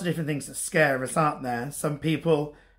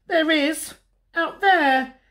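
high, insistent cub voice; Little Bear; 12 semitones higher, 4 dB louder, slower than usual. A narrator's reading. Little Bear shows Big Bear the night, high insistent cub voice.

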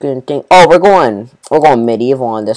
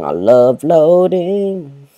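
sarcastic drawl; 3 semitones higher, much slower than usual. Pretend.

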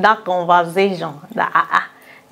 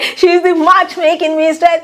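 much higher, louder excited intro; excited announcement; 11 semitones higher, 8 dB louder than usual. Retold or imitated. The other man introduces her on air: much higher, louder excited. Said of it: "He was so excited".

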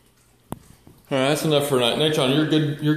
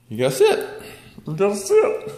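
higher, louder with a laugh; high coaxing to dog; 11 semitones higher, 3 dB louder than usual. Real, not acted. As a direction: laugh while telling the dog to sit.

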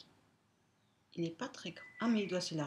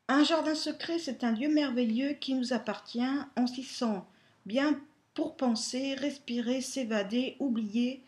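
reading aloud; 5 semitones higher, 6 dB louder than usual. Acted. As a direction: read it like a fairy tale. Reads the card aloud.

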